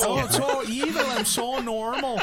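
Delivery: high, sing-song innocent act; fake innocent visitor. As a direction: play it sweet and harmless. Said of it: "Connor knocks the door".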